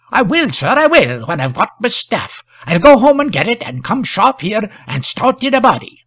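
high-pitched country constable; flustered rustic constable. A narrator's reading. Village constable wants his staff first, flustered.

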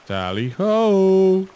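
high, loud, drawn-out jolly battle cry; excited cry. Acted. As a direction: hurl yourself in with a hearty Tally ho!. Jumping into the well.

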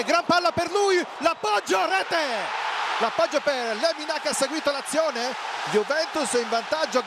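high, urgent goal commentary; excited goal call. Real, not acted. Commentator calls Juventus goal.

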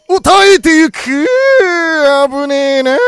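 sudden loud relieved yell; excited shout. Real, not acted. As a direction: shout in disbelief as it survives. Pokémon survives the hit.

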